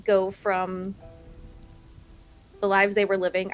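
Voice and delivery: ordinary delivery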